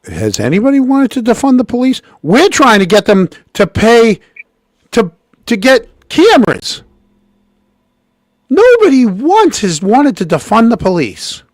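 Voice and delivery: high, loud, incredulous protest; outraged. Real, not acted. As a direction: fire back in real disbelief. Host outraged at clip claim.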